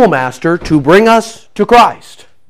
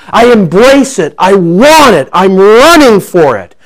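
raised, driving preacher's voice, higher and louder; fiery preaching; 6 semitones higher, 5 dB louder than usual. Acted. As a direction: preach it out, pushing each phrase. Preaching at full force.

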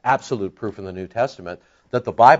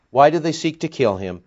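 usual speaking voice

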